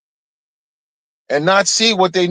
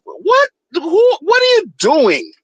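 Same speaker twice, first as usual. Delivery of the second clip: high squeaky disbelief; shocked; 11 semitones higher, slower than usual. Real, not acted. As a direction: shriek it in disbelief. Stunned reaction to the quote.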